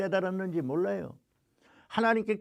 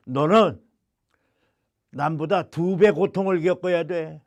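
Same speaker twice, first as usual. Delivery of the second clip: stern, louder divine command; loud stern God; 4 dB louder than usual. Retold or imitated. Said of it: "주시는 말씀이".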